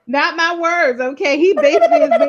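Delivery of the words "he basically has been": laughing. Real, not acted. Mid-laugh shared joke.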